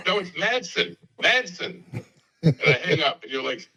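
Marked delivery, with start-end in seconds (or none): 0.1-1.8 high-pitched insistence on "Madsen"; retold insistence. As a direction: hit "Madsen" high, correcting a bad listener. Retold caller insists on name.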